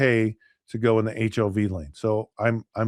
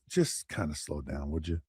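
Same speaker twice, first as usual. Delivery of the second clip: much lower, softer acted officer; low-key mild admonition; 8 semitones lower, 11 dB quieter than usual. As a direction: let them off with a hushed word. Officer letting driver off.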